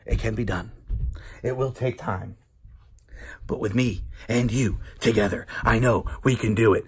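low, soft, measured pledge with pauses; solemn promo. Pretend. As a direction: drop low and rally the fans quietly. Hushed part of the promo.

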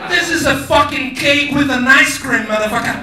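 loud, coarse shout of the translation; crude translation yelled. Retold or imitated. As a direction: bark the plain meaning at the crowd. Translating the fancy French phrase.

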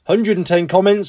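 ordinary delivery

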